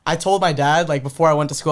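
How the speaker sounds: ordinary talk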